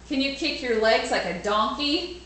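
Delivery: usual speaking voice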